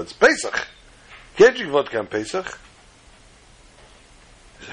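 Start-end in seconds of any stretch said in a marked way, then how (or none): 0.0-2.6 scolding. Acted. Fellow scolding Shmerel.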